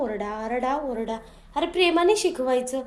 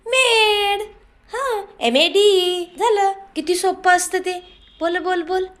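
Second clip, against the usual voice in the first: louder, higher, slow spelling drill; loud cheery teaching; 4 semitones higher, 4 dB louder, slower than usual. Shown as a demonstration. Mother loudly demonstrates spelling to the child for the father. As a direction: belt out each letter like an eager teacher. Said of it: "असं शिकवायचं असतं जरा मुलांना".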